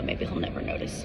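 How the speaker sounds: hushed secret whisper. Real, not acted. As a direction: whisper it so nobody hears. Hiding the spill from Mason.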